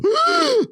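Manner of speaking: sudden high startled gasp; wife's sharp gasp. Retold or imitated. Girlfriend in the passenger seat, a sharp startled gasp. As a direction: gasp like something's about to hit. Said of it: "and then she'll go".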